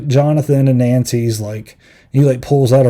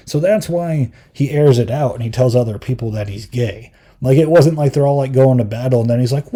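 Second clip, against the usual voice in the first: usual speaking voice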